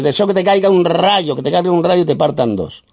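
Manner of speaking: mock curse. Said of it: "Por ejemplo, decirle".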